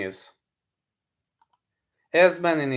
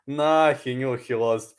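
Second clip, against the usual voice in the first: normal talking voice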